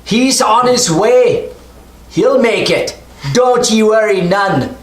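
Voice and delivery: high, slow gruff reassuring shout; gruff shouting over tugboat engine. Acted. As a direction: call it down reassuringly over the noise. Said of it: "Bill's like".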